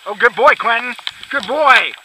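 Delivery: high, loud dog praise and calls. Real, not acted. [name] praising Quentin and calling him back, loud and high. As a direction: cheer the dog on from a distance.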